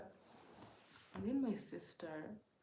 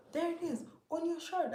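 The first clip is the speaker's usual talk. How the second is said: high, loud alarmed call; sister's alarmed call; 12 semitones higher, 11 dB louder than usual. Retold or imitated. Sister warning her it's on her shirt, high loud alarm. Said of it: "And she goes".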